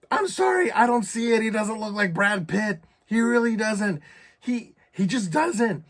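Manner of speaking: high-pitched mock-crying protest; retold tearful protest. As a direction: plead your case as if crying. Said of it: "But as I'm crying".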